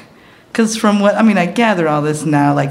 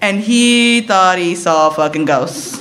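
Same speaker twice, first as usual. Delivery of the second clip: usual speaking voice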